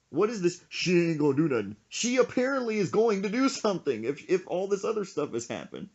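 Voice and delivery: high-pitched incredulous scolding, acted out; retold exasperated protest. Retold exasperated argument with his friend.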